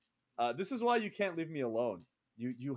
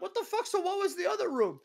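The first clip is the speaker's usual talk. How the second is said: much higher stunned outburst; shocked disbelief; 5 dB louder than usual. Retold or imitated. Host discovering his mistake, high shocked outburst. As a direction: gasp it out in disbelief. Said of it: "I'm like".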